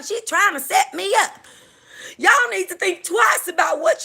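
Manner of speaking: high-pitched rant about being set up. Retold or imitated. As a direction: rant like a paranoid reality star. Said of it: "I can't do no [name], uh, imitations".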